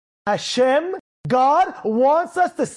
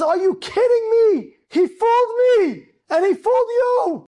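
high, loud cry of disbelief; outraged disbelief; 9 semitones higher, 4 dB louder than usual. Retold or imitated. Father learning from the Rosh Yeshiva he was fooled, outraged. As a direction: exclaim it, stretched and indignant. Acted father reacting to son's deception.

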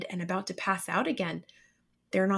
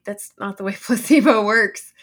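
loud, laughing disbelief; laughing retort; 9 dB louder than usual. Retold or imitated. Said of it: "and I'm like".

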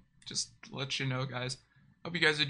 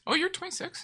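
surprised. Real, not acted. Reacting to Ziggy's age, a high startled squeak. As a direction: pitch jumps way up in disbelief. Shocked by friend's real age.

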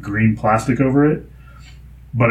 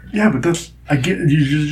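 ordinary delivery

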